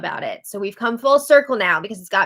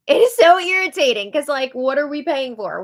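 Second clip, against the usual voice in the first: higher, louder burst of irritation; exasperated; 4 semitones higher, 5 dB louder than usual. Real, not acted. Viewer complains about blocked feeds, loudly exasperated. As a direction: let the annoyance burst out. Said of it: "This is so annoying".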